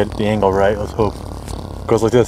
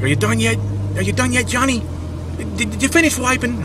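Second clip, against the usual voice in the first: high, nasal-free New York accent put on; New York accent, calling through a door; Michael Rapaport New York accent; 14 semitones higher than usual. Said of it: "I'm not gonna do a New York accent right now".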